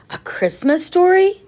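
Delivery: put-on a touch of a boy's curious asking; child's question. A narrator's reading. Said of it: "Marshall asked".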